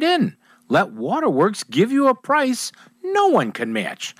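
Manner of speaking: punchy radio commercial read; emphatic local radio pitchman. Acted. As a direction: sell it with lively pitch swings. Reads a boat dealer ad.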